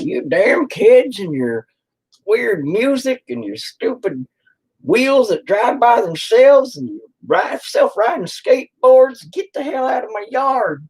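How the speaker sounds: put-on high, slow cranky old geezer voice; grumpy old man. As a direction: yell at kids off your lawn, slowly and high. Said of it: "me being an old man".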